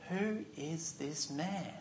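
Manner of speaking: slow, soft wondering question; a quiet, slow, awed murmur; hushed awe. Acted. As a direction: ask it under your breath, amazed.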